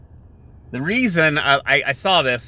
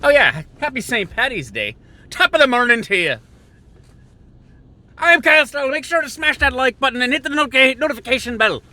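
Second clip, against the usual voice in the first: Jacksepticeye impression; 11 semitones higher, faster than usual. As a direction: yell it like a hyped Irish YouTuber intro. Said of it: "It's my Jacksepticeye impersonation".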